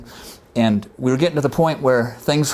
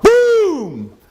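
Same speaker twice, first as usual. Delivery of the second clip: imitated explosion boom; 6 dB louder than usual.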